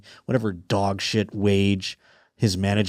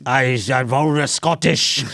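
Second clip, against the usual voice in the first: put-on Scottish accent, badly faked; attempted Scottish accent; 3 semitones higher than usual. Shown as a demonstration. Podcast host trying on request, shaky grasp of a Scottish accent. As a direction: have a clumsy go at Scottish. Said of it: "I can't do a Scottish".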